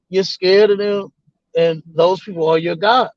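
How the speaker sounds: ordinary talk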